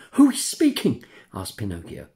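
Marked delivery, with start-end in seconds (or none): 0.1-1.0 high, startled voice frozen with fear; terrified question. Acted. Puppet in the dark, hearing a stranger, terrified. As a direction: ask in sudden terror into the dark. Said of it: "frozen with terror".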